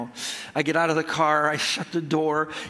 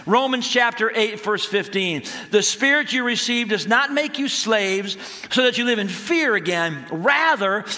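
raised, emphatic sermon delivery; preaching; 5 semitones higher, 3 dB louder than usual. Real, not acted. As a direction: drive the point home to a congregation. Preaches after telling the story.

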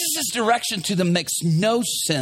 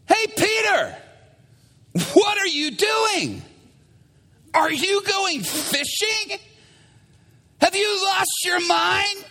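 high, drawn-out heckle across water; mocking call-out; 11 semitones higher than usual. Other crews heckling Peter from shore, mocking. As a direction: holler in high-pitched disbelief. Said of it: "And they're going".

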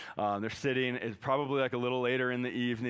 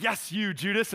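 high, louder, hammed-up accusation; mock denunciation; 10 semitones higher, 5 dB louder than usual. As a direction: point him out in pretend wrath. Imagined Jesus denouncing Judas.

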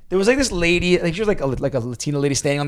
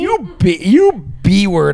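high, stretched-out mock insult; playfully retold outrage, pitched up; mock anger; 5 semitones higher, 4 dB louder, much slower than usual. Tired runner cursing the cheerful woman who passed, mock mad. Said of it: "we were so mad".

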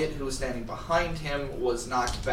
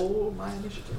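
whispering; 6 semitones higher, 5 dB quieter, faster than usual. Real, not acted. Whispered aside.

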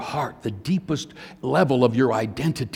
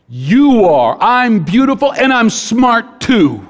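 put-on much louder and higher, a child's proud shout; triumphant little girl; 10 semitones higher, 11 dB louder, slower than usual. Daughter proudly declares to her father, a triumphant child's shout. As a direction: shout it like you just figured it out.

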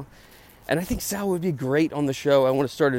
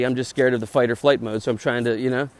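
normal talking voice

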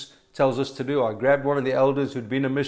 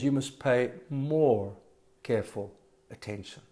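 gentle reminder; 3 semitones lower, 6 dB quieter than usual. Shown as a demonstration. Preacher contrasting scripture's wording with a blunt order, soft gentle urging. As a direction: ease off into a gentle appeal.

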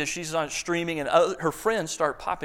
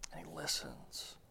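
whispered almost to nothing, much softer; hushed repeat; 16 dB quieter than usual. A narrator's reading. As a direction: let it fall away into a breathy hush.

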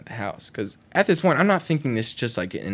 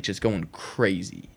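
normal talking voice

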